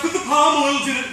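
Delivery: high, loud, panicked shout. Acted. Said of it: "then the P A shouts".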